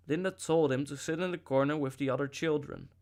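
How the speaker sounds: ordinary delivery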